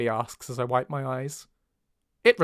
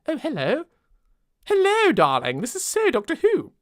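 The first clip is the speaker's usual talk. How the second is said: high, plummy posh English voice; 11 semitones higher, 3 dB louder than usual. Shown as a demonstration. Reader hamming up Haley as a posh English girl. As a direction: put on an exaggerated upper-class English accent. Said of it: "I made her really posh there".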